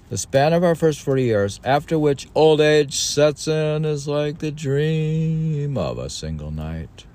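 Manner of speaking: put-on high, loud, solemn recitation of old text; declaiming recital. Reading an ancient text aloud to a co-host, solemnly declaimed. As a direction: read it like scripture from a lectern.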